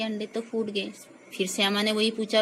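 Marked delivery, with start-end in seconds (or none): none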